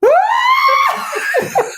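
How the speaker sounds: very high pushing squeal; high-pitched squeal. Retold or imitated. Mother acting out the final push, high squeal. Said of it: "And I went".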